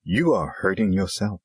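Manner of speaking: ordinary delivery, nothing marked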